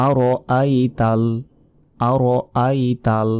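আরআইতাল said very slowly, every syllable drawn out; slow, precise recitation of আরআইতাল; correct Quranic pronunciation. Shown as a demonstration. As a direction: sound out আরআইতাল like a careful teacher. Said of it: "প্রথমে এখানে বলতে হবে".

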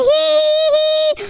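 high teasing laugh in mom voice. Acted. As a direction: cackle like a mom closing in. Mom nearly finds baby.

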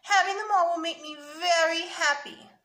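put-on high, loud pleading little boy; mildly acted sniffly kid; sniffly child. A narrator's reading. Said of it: "sniffed Sammy".

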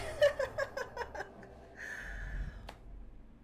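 long high breathy giggle; real laughing fit. Real, not acted. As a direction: break into helpless high laughter. Laughing at the kiloseconds gift.

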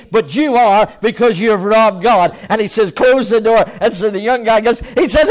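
loud, high, driving sermon storytelling; full-force preaching. Acted. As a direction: belt the story out to the congregation.

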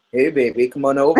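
low, husky come-on, imitating a man; deep man voice.

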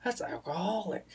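high-pitched mock correction of a friend; imitated correction. Friend correcting a misspelled alcohol, imitated correction. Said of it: "He looks at me and he goes".

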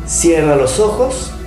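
calm guided meditation voice; right in your ear. Acted. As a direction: guide them gently into stillness.

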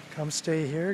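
usual speaking voice

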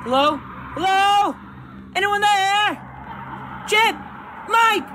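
high, loud shouts for friends; frantic calling out. Real, not acted. Player searching for Chip and Mike, shouting frantically. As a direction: yell their names into the dark.

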